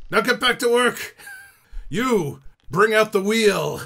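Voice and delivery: loud, high-pitched shout across the deck; ship's officer shouting commands; barked crew orders. Acted. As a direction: bellow orders at your sailors. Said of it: "he turns to the rest of the crew".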